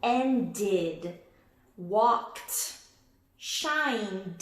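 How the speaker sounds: Ended, walked, shined each said with a clear different ending; carefully sounded-out past tense endings; ending contrast demo. Shown as a demonstration. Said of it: "listen how I say these words".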